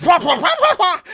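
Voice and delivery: high, loud "wah" noises; comic wail of an actor hit in the face; wailing mock cry. GM mimicking the Boo actor toppling off stilts, comic wailing. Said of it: "and he f-, goes".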